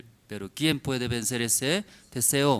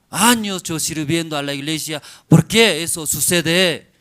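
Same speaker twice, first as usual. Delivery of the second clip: complaining believer; 6 semitones higher, 10 dB louder than usual. Acted. Servant girl grumbling to God about her fate, loud complaint. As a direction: cry out a bitter complaint. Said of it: "puede murmurar".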